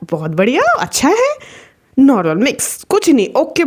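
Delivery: lightly voiced, unbothered approval; casual normal reaction. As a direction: imitate an easygoing dad brushing it off. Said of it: "उनका normal reaction". (बेफ़िक्र, सहज अंदाज़)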